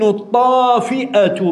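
الطافئة with a long drawn-out ā and a glottal stop before the ending; pronounced with hamza. Shown as a demonstration. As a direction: stretch الطافئة and break before its last syllable. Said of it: "بالهمز".

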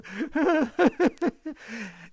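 high, loud jeering cackle; coarse mocking laugh. A narrator's reading. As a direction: laugh in his face. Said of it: "ridere di un riso così sguaiato e canzonatore".

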